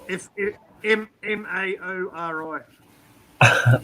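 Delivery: letters spelled out slowly, with the last one loud; slow, deliberate spelling. Real, not acted. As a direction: spell the letters slowly, then punch the final I. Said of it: "hard for me to hear when you're spelling".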